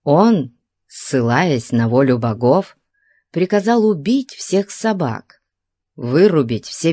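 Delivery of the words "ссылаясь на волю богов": usual speaking voice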